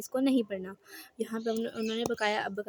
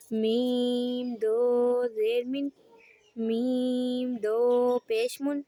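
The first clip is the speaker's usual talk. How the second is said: मीम दो ज़ेर मिन spelled slowly, each part apart; slow spelling drill; much slower than usual. Shown as a demonstration. Teacher models tanween spelling for the class. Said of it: "उसकी हिज्जे इस तरह करेंगे".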